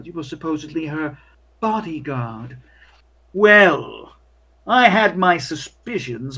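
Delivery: haughty hotel clerk, slow and cold; snooty waiter. Acted. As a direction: look down your nose at the guest.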